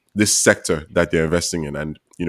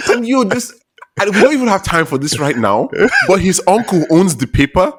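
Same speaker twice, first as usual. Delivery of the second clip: excited; 11 semitones higher, 3 dB louder than usual. Real, not acted. Shocked reveal about the uncle.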